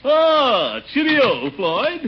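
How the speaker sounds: breezy mock-English hello; hamming it up for the barber; jaunty greeting. Showing off English riding outfit.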